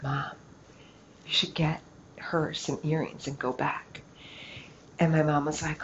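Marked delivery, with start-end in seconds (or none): 0.0-4.0 hushed, voiceless whisper. Retold or imitated. To her mom in the store, urgent whisper. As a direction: whisper it so nobody nearby hears.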